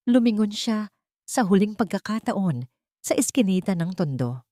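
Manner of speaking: usual speaking voice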